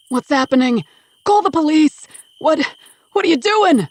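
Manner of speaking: high, loud panicked shouts; a father panicking as his son turns away; panicked shouting. Acted.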